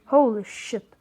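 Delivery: usual speaking voice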